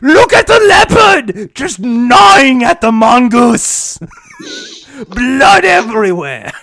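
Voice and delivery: high, loud, slow over-the-top shouting; excited commentator shouting. Pretend. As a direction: yell it like a robot-fight commentator. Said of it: "they should have gotten Jonathan Pierce to do Blue Planet".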